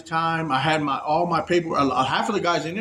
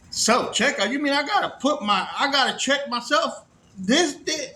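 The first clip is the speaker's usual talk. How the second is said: incredulous; 8 semitones higher than usual. Retold or imitated. Incredulous at self-checkout.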